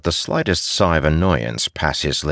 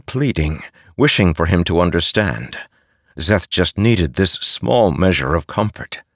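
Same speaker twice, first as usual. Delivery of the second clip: plain reading voice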